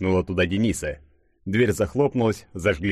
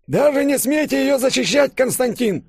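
put-on very high, louder cranky old-man voice; querulous old man; 5 dB louder than usual. A narrator's reading. Old professor under the table scolding Konstantin, querulous. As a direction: scold like a grumpy elder, pitched way up. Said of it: "раздался ворчливый старческий голос из-под стола".

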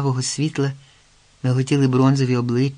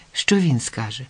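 ordinary delivery

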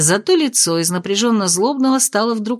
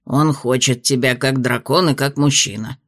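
lower, measured spirit voice; low dragon spirit voice; 8 semitones lower than usual. A narrator's reading. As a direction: speak as a wise old dragon spirit. Spirit speaks.

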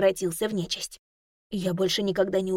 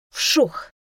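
sharp, louder whoosh of a swung stick; 5 semitones higher, 8 dB louder than usual. Acted. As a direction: swing it hard, whoosh. Louder final swish.

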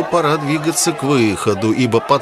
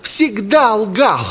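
high, overwrought accusation; accusing boy; 11 semitones higher than usual. A narrator's reading. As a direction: denounce him in a high voice.

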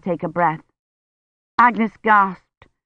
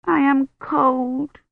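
put-on whimpering child; 7 semitones higher, much slower than usual. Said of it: "began to sob".

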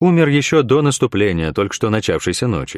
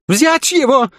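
very high, louder command; shouted order; 4 dB louder, slower than usual. A narrator's reading. Young commander ordering his soldiers, high furious shout. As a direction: yell the order to seize him.

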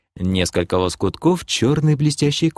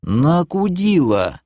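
very slow, high, stretched word; parroted retort; 7 semitones higher, slower than usual. Acted. Elf repeats it to the demoness, slow drawl. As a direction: draw out every syllable innocently.

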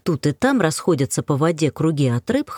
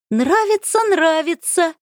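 high gleeful teasing chant; giggly prank whisper; 13 semitones higher, slower than usual. Acted. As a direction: sing it out, delighted to tease. Она захлопала в ладоши. (радостно дразнясь)

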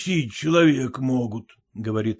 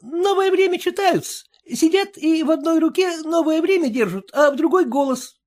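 put-on high, eager young boy; a timid young servant boy reporting; 13 semitones higher, 3 dB louder than usual.

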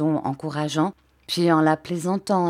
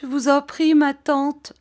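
high, distressed begging; acted tearful entreaty; faltering plea; 8 semitones higher, 4 dB louder than usual. A narrator's reading. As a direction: beg in a high, emotional voice. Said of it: "balbutia Émilie".

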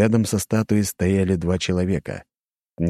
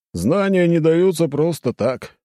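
philosophical; 11 semitones higher, 4 dB louder, slower than usual. Acted. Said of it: "философски заметил второй стражник".

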